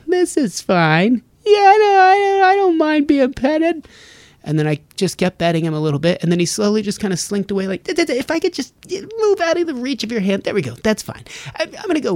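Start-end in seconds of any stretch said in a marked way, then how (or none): 0.1-3.9 very high, slow put-upon cat; high whiny cat pretending it's fine. Voicing the grumpy cat Otis. 7.8-12.1 high, stammering cat backing away; high nervous cat inching out of reach; awkward cat voice. Retold or imitated.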